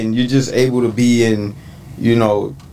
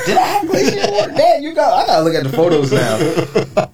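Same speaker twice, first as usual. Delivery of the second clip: high, laughing self-doubt; 6 semitones higher than usual. Real, not acted. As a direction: laugh at your own memory slip. Unsure, laughing at himself.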